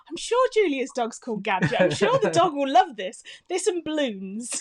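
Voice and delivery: bursting with a fun idea, playfully overexcited; excited retelling. Retold or imitated.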